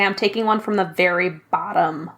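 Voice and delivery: normal talking voice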